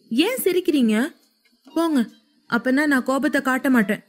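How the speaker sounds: high-pitched sulky protest; young wife sulking at her laughing husband, high and pouty; pouting annoyance. A narrator's reading. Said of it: "கோபத்தில் வாய் சுழித்து".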